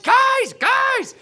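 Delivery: high, loud call to the team; yelling. Retold or imitated. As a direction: call out for friends far away. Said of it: "I started yelling".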